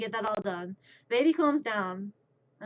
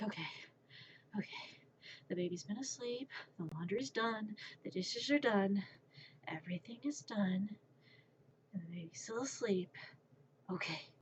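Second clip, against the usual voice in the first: very soft, near-whispered checklist; hushed self-reassurance; 9 dB quieter than usual. Retold or imitated. Babysitter checking off chores beside sleeping baby, hushed.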